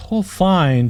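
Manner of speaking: a spoiled princess scoffs; scoffing. A narrator's reading. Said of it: "Jazz scoffed".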